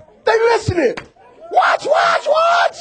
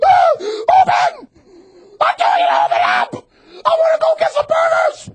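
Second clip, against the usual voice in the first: screaming at the car; 13 semitones higher than usual. Retold or imitated. Preacher mimicking a frustrated driver, shouting. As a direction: yell at a car that won't open. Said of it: "you're sitting there screaming at the car".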